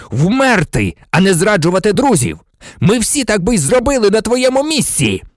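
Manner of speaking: high, forceful, outraged shout; roared reproach. A narrator's reading. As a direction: roar the reproach at a false friend. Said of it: "заревів Блек". (гнівний докір на підвищеному голосі)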